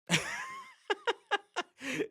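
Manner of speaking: long high-pitched chuckle; amused laugh. Real, not acted. Host amused by [name] doing whatever, amused laugh.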